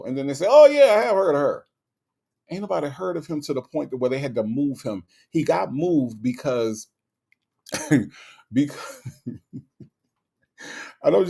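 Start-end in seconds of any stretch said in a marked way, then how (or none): 0.5-1.7 imitated dawning realization, higher and louder; sudden recognition. Locals reacting to a radio show's name, mock sudden recognition. 7.7-11.3 real laughter breaking into the words; cracking up. Real, not acted. Host cracking up over a jailed nobody, laughing through words.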